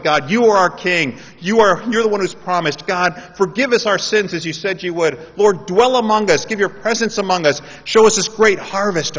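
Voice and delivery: loud, high, fervent cry to God; fervent prayer. Acted. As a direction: lift the prayer with full force. Preacher voicing congregation's prayer.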